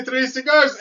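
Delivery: high, put-on voice; high mock voice. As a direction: mimic himself at the seller. Retold firm answer to seller.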